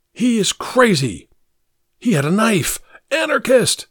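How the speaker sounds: high, agitated yelling; men pinning the thief, high yelling; shouted accusations. A narrator's reading. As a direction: denounce him to the crowd.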